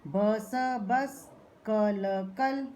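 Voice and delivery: ब स बस, each sound drawn out slowly then joined; slow blending. Shown as a demonstration. Teacher slowly sounding out ब स बस for pupils. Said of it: "कैसे उच्चारण करेंगे".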